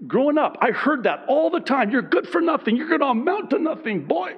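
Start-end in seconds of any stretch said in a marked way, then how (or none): 1.9-4.3 a mean father's taunt, pitched higher, quick and cutting; mean dad. Retold or imitated. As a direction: sneer it like a harsh father putting his boy down. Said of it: "he always said it in a mean, you know, with his, uh, accent".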